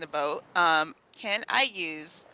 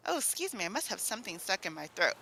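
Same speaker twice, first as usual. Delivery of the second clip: sheepish cover-up; 8 dB quieter, faster than usual. Acted. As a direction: excuse yourself softly after a fake cough. Covers up a faked cough.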